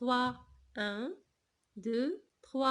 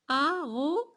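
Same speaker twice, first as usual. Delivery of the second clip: syllable by syllable; 3 semitones higher than usual. Shown as a demonstration. Teacher showing where z falls, "Arrosoir" broken up. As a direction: stretch "Arrosoir" with a break before the z. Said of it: "Début, milieu ou bien la fin".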